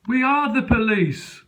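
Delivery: high, slow police answering together; mock police chorus. Officers reply together through the door, high and slow. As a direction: say it in chorus like smug coppers.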